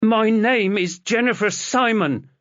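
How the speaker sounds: put-on girl rejecting the father she never knew; defiant retort. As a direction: throw the name back at him. Chloe defiantly rejects her name.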